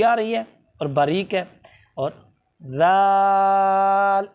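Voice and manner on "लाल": लाल held very long and thin, no whistle; drawn-out thin letter. Shown as a demonstration. Teacher demonstrating the thin letter, long slow hold. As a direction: stretch लाल out slowly and thinly. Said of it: "सीटी नहीं और बिल्कुल बारीक है".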